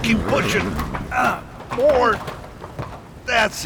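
high, strained push; straining effort. Acted. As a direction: call out while heaving hard. Shoving horses into tunnel.

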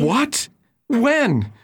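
high, startled what and when; jolted by the news; shocked questions. Acted. Hears the gold was stolen.

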